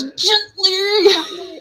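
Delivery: high, loud, sobbing cry of the word; passionate cry. Retold or imitated. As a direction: cry it out with longing. Buttercup grabs Wesley's face.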